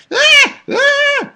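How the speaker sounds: high loud shrieking scream; short piercing demo screams; mock scream. Shown as a demonstration. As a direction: shriek on request like a kid.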